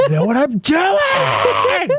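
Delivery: slow hushed shout, high; boring question answered with a whispered yell. Shown as a demonstration. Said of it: "whisper yell".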